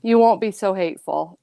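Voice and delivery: ordinary talk, nothing marked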